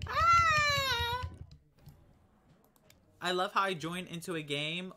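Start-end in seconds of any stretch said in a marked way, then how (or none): 0.1-1.3 sudden high-pitched scream. Real, not acted. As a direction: shriek in fright. Scream when caught.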